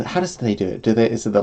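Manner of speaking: ordinary talk, nothing marked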